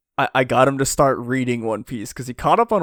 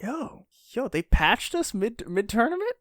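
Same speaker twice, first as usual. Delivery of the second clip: high, hushed astonishment; imitating a stunned player, pitched up; mock disbelief; 10 semitones higher, 3 dB quieter than usual. Co-host voicing fighters surprised mid-battle, high mock disbelief. Acted amazed reaction of the fighters.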